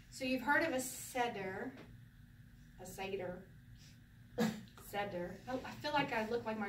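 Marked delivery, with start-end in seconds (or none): 0.8-1.7 "seder" said SED-er; "seder" with short e. Shown as a demonstration. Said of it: "I say Seder". 2.8-3.4 "seder" said SAY-der; "seder" with long a. Shown as a demonstration. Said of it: "you say Seder". 4.9-5.3 "Seder" said SED-er; "Seder" with short e. Shown as a demonstration. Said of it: "I say Seder".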